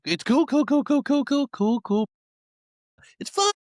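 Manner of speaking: high, loud, rapid "cool, cool, cool"; faking calm after a scare, high and strained; mock calm.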